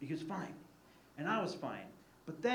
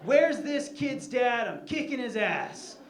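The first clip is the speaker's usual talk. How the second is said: retold yell; 6 semitones higher, 7 dB louder, slower than usual. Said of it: "I yell out".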